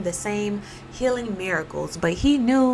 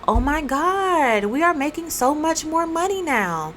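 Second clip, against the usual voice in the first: amazed owners; 7 semitones higher, 3 dB louder than usual. Retold or imitated. Business owners amazed at profits.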